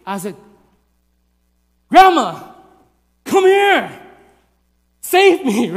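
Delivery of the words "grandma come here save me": loud, high mock-furious yells; spaced-out acted shouts; angry screaming. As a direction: holler urgently for rescue in a tough voice. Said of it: "like a mad, angry voice".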